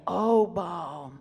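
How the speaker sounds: a stretched, mocking plea to Baal; drawn-out mock prayer. Preacher mimics Baal's prophets in a drawn-out plea. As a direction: moan the name like an endless prayer. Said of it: "Long prayers saying".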